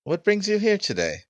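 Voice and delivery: very high put-on voice; theatrical high-pitched inquiry; flamboyant host. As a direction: ask it high and grandly. Host questions the visitor in character.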